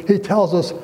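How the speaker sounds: ordinary talk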